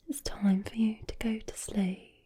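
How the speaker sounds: whispered Brummie bedtime line. Shown as a demonstration. Creator sending the listener to sleep, whispered Brummie accent.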